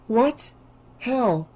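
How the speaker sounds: high, stunned questions; shocked. Acted. Sister hears of friends' deaths, high shocked questions. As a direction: react stunned, voice jumping up.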